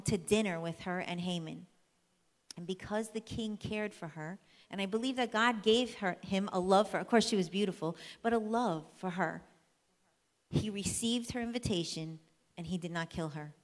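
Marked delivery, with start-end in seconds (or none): none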